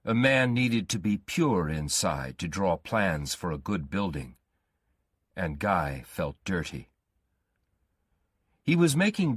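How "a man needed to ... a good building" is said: ordinary delivery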